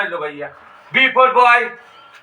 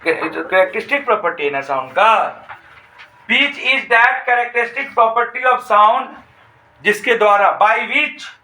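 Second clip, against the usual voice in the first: very slow dictation, word by word with long pauses; much slower than usual. Shown as a demonstration. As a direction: spell it out slowly so students can write. Teacher dictates definition slowly.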